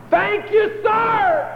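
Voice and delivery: high, full-voiced cry of thanks to heaven; shouted thanks. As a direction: throw it up to the sky at the top of your voice. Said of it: "to the top of her voice".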